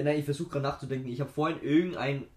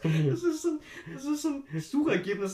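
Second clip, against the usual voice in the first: laughing; 12 semitones higher than usual. Real, not acted. Laughing at search result.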